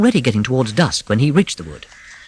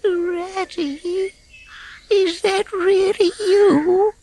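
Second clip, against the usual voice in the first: high, thin, slow little voice from a hole; a weak creature hoping to be rescued; feeble voice; much slower than usual. A narrator's reading. Frightened mole calling to his rescuer from the hole, feeble. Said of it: "came a feeble voice saying".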